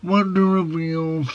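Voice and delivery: ordinary delivery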